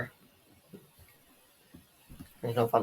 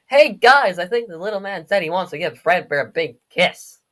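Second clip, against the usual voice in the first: jeering bully; 4 semitones higher, 8 dB louder than usual. Acted. Voicing bully egging on friends.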